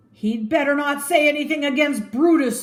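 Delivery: high, loud warning yell; shout from crowd. Acted. A citizen shouting at the crowd, loud. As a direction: shout a threat across the square. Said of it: "someone shouted".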